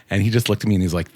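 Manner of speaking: normal talking voice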